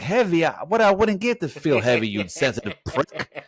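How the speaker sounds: high, indignant mock outburst; offended movie character. Acting out the offended wheelchair brother, high mock indignation. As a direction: mimic someone taking offence, pitched well up.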